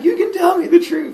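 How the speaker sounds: put-on very high, loud kid voice; squeaky, comically desperate insistence; mock pleading child. Boy on stairs pressing his captor, high loud plea.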